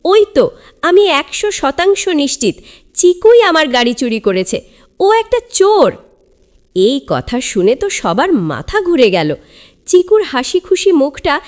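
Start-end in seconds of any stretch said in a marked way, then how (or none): 0.1-5.9 shouting. A narrator's reading. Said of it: "চিৎকার করে বলল".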